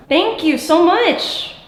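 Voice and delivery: gasping, thrilled thank you; breathless with surprise; excited gasp. Real, not acted. Light flickers after their question.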